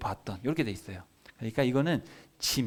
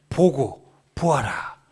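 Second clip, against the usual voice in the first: loud, separate imperatives; booming acted command; God commanding; 6 dB louder, much slower than usual. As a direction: proclaim each word as a divine order. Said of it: "이렇게 하나님이 명령을 하세요".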